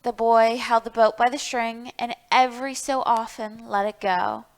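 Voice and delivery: ordinary delivery, nothing marked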